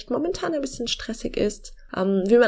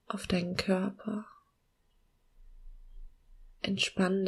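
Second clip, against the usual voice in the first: slow, soft meditation voice with long pauses; calm guiding voice, gently slowing down; guided meditation; 5 dB quieter than usual. Acted.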